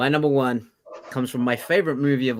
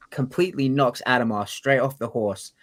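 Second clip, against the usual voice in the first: ordinary delivery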